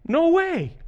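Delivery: put-on playful kid gasp; mock amazement. Kid's amazement voiced.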